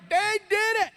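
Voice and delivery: high, excited put-on accent shouting; hammed-up panicked crowd voice; mock Indian crowd yelling. Indian audience panicking at the bat, mock yelling. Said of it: "They were yelling".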